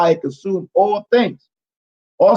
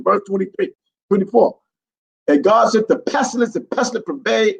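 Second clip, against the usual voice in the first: normal talking voice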